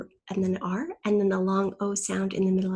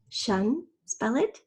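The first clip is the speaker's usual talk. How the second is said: ordinary delivery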